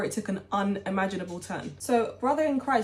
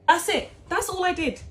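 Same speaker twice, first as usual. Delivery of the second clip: exasperated; 8 semitones higher, 3 dB louder than usual. Real, not acted. Exasperated emphasis.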